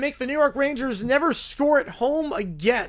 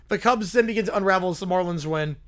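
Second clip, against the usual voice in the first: usual speaking voice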